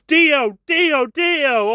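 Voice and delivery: high, repeated mock cries; mock yelling. Imitating Jonathan yelling Dio over and over, mock yelling. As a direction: hammer the name like a melodramatic hero. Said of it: "he just yells".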